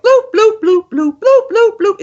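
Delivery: high, loud bloops, as recorded; dripping water sounds. Shown as a demonstration. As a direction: bloop like water drops, forward. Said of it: "instead of, like".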